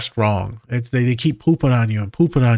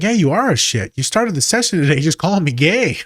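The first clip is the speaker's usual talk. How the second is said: teasing comeback; 6 semitones higher, 3 dB louder than usual. Retold or imitated. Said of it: "I was like".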